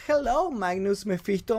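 lightly imitated English-speaking guest; imitating Sabrina. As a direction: echo her greeting, slightly drawn out. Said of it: "escucharla a ella decir".

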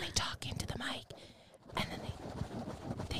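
breathy ASMR whisper. Shown as a demonstration. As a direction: hush it like an ASMR video. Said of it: "they whisper".

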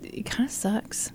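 breathy whispered aside; whispering. Real, not acted. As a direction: whisper it under your breath. Groaning about pulled wisdom teeth.

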